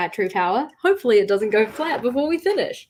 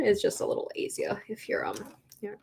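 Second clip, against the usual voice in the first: whispering; 10 dB quieter than usual. Real, not acted. Soft aside to viewers.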